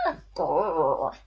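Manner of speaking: low growly worry creature; playful monster growl; worries growling. Acted. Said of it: "And they're going".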